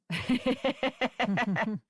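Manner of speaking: real, amused burst of laughter. Real, not acted. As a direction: laugh at your own easy solution. Laughs at her own solution.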